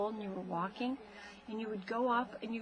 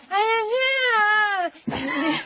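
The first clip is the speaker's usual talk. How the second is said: put-on high, loud squealing baby voice; imitating toddler; 13 semitones higher, 11 dB louder than usual. Mother imitating her toddler son's frantic babble. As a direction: mimic a toddler's excited squeal. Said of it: "And you go".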